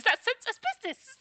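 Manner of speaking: very high squeaky put-on voice. Mocking a games company's business, squeaky voice. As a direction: jump up into a cartoonish squeak.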